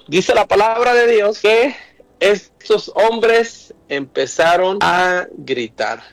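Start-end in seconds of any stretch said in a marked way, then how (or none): 2.2-6.0 slow emphasis. Acted. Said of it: "preacher slows for emphasis".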